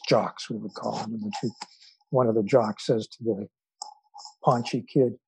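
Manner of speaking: usual speaking voice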